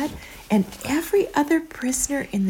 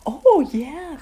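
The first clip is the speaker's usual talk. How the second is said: excited; 7 semitones higher, 5 dB louder than usual. Real, not acted. Praising child's snoring sound.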